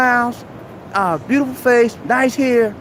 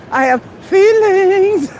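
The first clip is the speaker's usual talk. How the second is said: high, slow, hammed-up wounded whine; mock hurt feelings; 8 semitones higher, 3 dB louder, much slower than usual. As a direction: overplay being hurt, stretched and louder. Mock emotional take, then laughs.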